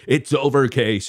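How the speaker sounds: loud high taunt; taunting shout. Real, not acted. Taunting the rival, telling Case it is over in a loud high shout. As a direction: shout it at him to rub it in.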